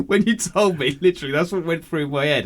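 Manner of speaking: high, loud, talking through laughter; laughing. Real, not acted. Telling co-host what they pictured, laughing hard. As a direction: barely get it out for laughing. Cracking up at own twig impression.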